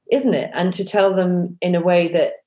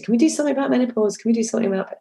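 quick, higher, pestering ask; nagging request; 6 semitones higher, much faster than usual. Retold or imitated. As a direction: nag the producer over and over. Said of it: "I kept nagging".

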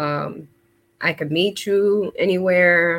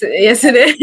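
ordinary talk

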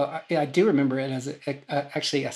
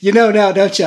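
teasing quote; 6 semitones higher, 12 dB louder than usual. Retold or imitated. Host teasing winner at ceremony.

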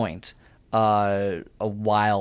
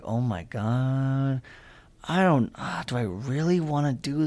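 slow awed dread. Retold or imitated. Recalling the roller coaster line, slow stunned dread. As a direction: stretch it out, dreading what's ahead.

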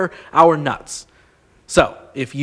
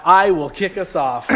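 usual speaking voice

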